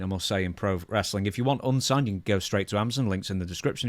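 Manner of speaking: ordinary talk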